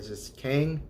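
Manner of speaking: Kang, bare syllable; clipped Kang. Shown as a demonstration. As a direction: say Kang short, no ending vowel. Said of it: "Just say Kang without any of the E sound".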